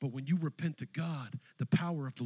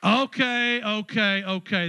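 loud, high okays fading down; riding the applause; 9 semitones higher, 10 dB louder, slower than usual. Real, not acted. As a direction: cheer them on then settle. Loud hype over applause.

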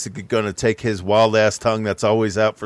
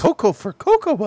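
high-pitched cartoon bird catchphrase; Sonny the Cuckoo Bird; 11 semitones higher than usual. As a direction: squawk out a cereal mascot slogan in a high put-on voice. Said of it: "Sonny the Cuckoo Bird".

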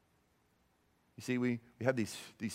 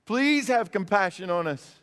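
high, pleading cry of a desperate father; a father pleading with Jesus for his son, raised and desperate; begging; 6 semitones higher, 4 dB louder than usual. Pretend. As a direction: beg for mercy with everything. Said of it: "he just begs Jesus".

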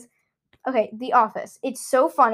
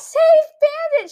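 high slow shout; quoting the show; 14 semitones higher, 3 dB louder, slower than usual. Retold or imitated. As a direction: cry it out like the panicked character. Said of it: "She throws her cat".

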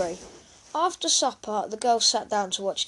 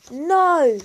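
high, loud, drawn-out No; loud yelled protest; 8 semitones higher, 11 dB louder than usual. A narrator's reading. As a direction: yell the refusal in protest. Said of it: "yelled Bertie".

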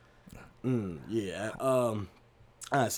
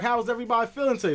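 quick, high, cheery teacher question; 8 semitones higher, faster than usual. Retold or imitated. The teacher checks on the kids' feelings, high and quick. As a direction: play the bubbly pre-K teacher. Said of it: "Miss Commander's like".

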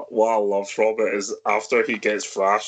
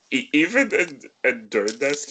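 ordinary delivery

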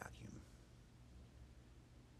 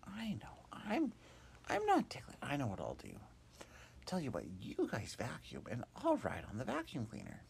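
Hop's idea; 8 semitones higher, 5 dB louder than usual. Acted. Hop voiced planning a ride.

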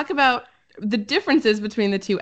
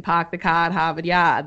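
R-less Boston accent; 3 semitones lower than usual. Shown as a demonstration. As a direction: drop every R like a Bostonian. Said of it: "like in Boston".

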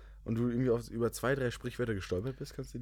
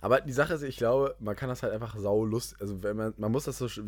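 usual speaking voice

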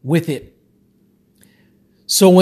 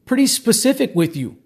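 ordinary talk

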